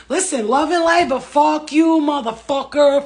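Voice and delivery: loud, high furious rant; angry outburst. Real, not acted. Cursing out unsupportive family, loud and high. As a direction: blast them with it.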